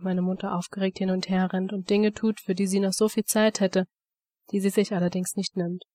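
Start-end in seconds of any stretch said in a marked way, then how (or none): none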